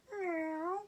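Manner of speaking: meow. Acted. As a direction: mew like a cat. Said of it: "cat and cow".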